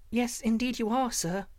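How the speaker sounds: put-on high-pitched, polite child; little girl's voice. A narrator's reading. Slave girl reassures the young man, high little-girl voice. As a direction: answer respectfully, pitched well up. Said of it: "she replied in answer to his look".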